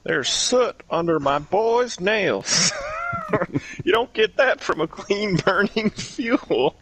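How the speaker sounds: high, slow cartoon dad impression; Hank Hill. Doing a convincing impression for friends on request, Hank Hill voice. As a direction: stretch it out slowly in a high character voice. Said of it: "that was a really good impression".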